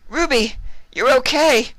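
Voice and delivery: very high, loud joyful shriek; happy shriek. Acted. Vinyl shrieks happily on seeing her friend alive. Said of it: "Vinyl shrieked happily".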